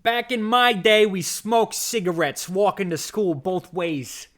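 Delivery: put-on loud, slow old-timer's boast; hammed-up "back in my day" rant; mock old-timer. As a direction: declaim it slowly like a proud old-timer.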